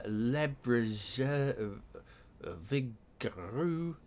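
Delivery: slow, halting attempt at a French accent; butchered French. Real, not acted. Player struggling through French road signs, butchered French accent. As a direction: sound out French names haltingly, drawing them out. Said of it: "Butchering my French throughout".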